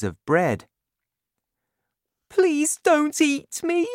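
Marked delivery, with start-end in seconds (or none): 2.3-3.9 put-on much higher, frightened little girl; high frightened child begging; Sophie crying out. A narrator's reading. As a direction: plead in a scared child's voice. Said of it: "cried Sophie".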